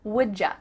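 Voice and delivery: "Would ya" as one "woodjuh"; short linked form; reduced wouldja. Shown as a demonstration. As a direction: blend "Would ya" with a j.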